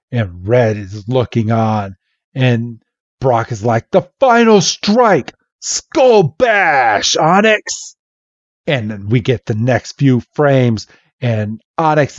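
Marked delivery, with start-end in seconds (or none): 3.9-7.9 high cartoon battle cry; hammed-up trainer's order, drawn out; attack command. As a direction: call the finishing move dramatically. Brock orders the finishing attack.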